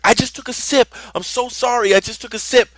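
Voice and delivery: imitating a kid begging forgiveness; high, hurried little-brother plea; panicked pleading. Brother pleads with angry dad.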